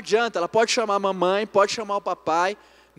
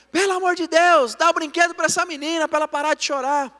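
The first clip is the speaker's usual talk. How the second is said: higher, louder imitation of a fed-up mother; exasperated wife; 8 semitones higher, 6 dB louder than usual. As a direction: call out a pleading complaint from the kitchen. Imagined exasperated wife calling from kitchen.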